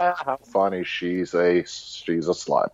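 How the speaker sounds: mock how hilarious the joke is; sarcastic amusement. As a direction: fake laugh, then dry low deadpan. Mocks the film's joke.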